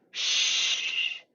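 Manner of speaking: long steady hushing sh; drawn-out airy sh; unvoiced sh. Shown as a demonstration. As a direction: sustain a shushing breath with no voice. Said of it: "it's the voice version of".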